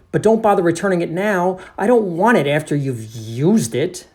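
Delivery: high, loud, huffy refusal; exasperated narrator. Acted. Narrator refuses the used period, exasperated. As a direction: refuse it with indignant disgust. Narrator character argues with janitor.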